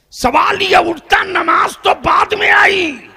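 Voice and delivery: high, loud declaimed shout; full-force preaching. Real, not acted. Preacher raises a question to the congregation, full-force preaching. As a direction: throw the question out at full power.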